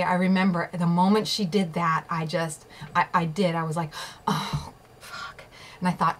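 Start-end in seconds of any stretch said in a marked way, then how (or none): 4.3-5.4 retold whispered curse to herself; whispered dismay. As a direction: whisper it as a sinking private reaction. Retelling her own dismayed thought.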